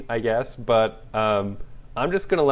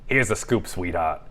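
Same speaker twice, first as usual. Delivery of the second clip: put-on New York accent; 5 dB louder than usual. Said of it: "With a New York accent".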